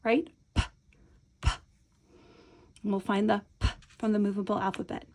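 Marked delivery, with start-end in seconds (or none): 0.5-1.5 crisp P sound on its own; isolated sound. Shown as a demonstration. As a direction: tap out the P sound. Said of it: "What's that first sound".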